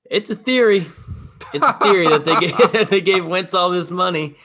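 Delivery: laughing while talking; words broken by real laughter. Real, not acted. Laughs through the theory.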